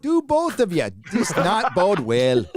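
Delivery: mock the bad film Irish accent; deliberately terrible Irish accent, slowed down; appalling Irish accent. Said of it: "in an appalling Irish accent".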